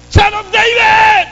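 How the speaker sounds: very high, loud, slow cry; blind man's shout. Acted. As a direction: shout over everyone, stretch it. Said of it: "Anong sinigaw niya?".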